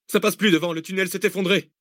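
high, tense explanation; alarmed Zach. Acted. Zach telling the others the tunnel collapsed, alarmed. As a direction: tell them the way is gone.

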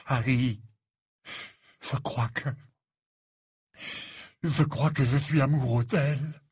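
halting, choked-up love confession; strangled voice. A narrator's reading. Ron confesses his love to Harry, voice strangled. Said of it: "déclara Ron d'une voix étranglée".

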